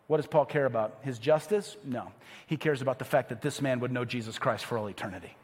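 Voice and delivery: normal talking voice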